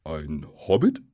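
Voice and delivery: puzzled troll with a touch of alarm; slightly startled troll. A narrator's reading. Troll repeating the hobbit's word, slightly startled question. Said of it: "ein bisschen erschrocken".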